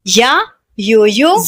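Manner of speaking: या, योयो drawn out slowly; teacher stretching या and योयो; slow y demo. Shown as a demonstration. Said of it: "वाई का sound क्या होता है".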